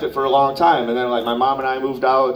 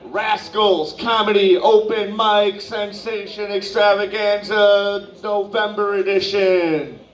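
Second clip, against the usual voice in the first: announcer voice; 7 semitones higher than usual. Acted. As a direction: stretch it out like a game show host. Host announcing the show.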